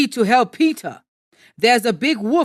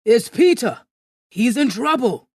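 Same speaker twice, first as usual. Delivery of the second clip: raised, alarmed voice; alarmed shout; 4 dB louder than usual. A narrator's reading. Villager to the gathering crowd, shouting in alarm. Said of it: "shouted someone else".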